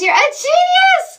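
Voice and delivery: loud, high cry of praise; excited shout. Retold or imitated. Therapist gushing about her client, excited high shout. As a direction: belt it out like a thrilled fan. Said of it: "She says".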